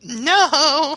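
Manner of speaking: high, loud, put-on no; mock flat refusal. A date refusing to meet, high loud no. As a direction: bark a silly refusal.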